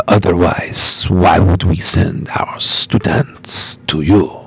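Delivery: put-on slow, low telenovela Mexican accent; low, brooding Latin soap-opera accent. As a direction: object in a low, drawn-out mock Mexican accent. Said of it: "trying to do my telenovela".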